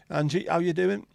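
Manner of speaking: high, loud hello to Angie; bright, loud welcome to a viewer; cheery greeting. Real, not acted. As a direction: call out a welcome.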